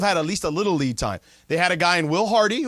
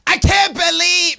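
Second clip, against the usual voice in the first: much higher, louder, slowed Boston yell; half-started Boston radio impression; attempted Boston radio voice; 12 semitones higher, 7 dB louder, slower than usual. Shown as a demonstration. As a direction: try a ranting Boston host. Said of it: "You got a Boston radio voice?".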